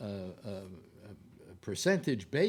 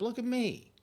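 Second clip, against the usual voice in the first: much higher, sing-song Look at me; playful look at me; 11 semitones higher than usual. Pretend. Vlogger teasing his viewers, much higher playful voice. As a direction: pipe up like a kid wanting attention.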